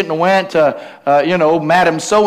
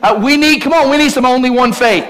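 raised, insistent, rallying sermon delivery; impassioned preaching; 5 semitones higher, 4 dB louder than usual. Real, not acted. Preacher urging the congregation to believe, building with fervour.

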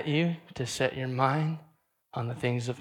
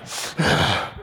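laughing sigh; 4 semitones lower, 6 dB louder than usual. Real, not acted. Laughing at own gory joke.

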